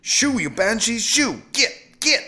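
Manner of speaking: high, loud shooing cries; urgent, high-pitched bursts. A narrator's reading. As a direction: shout as if driving pests away. Husband chasing banshees with broom.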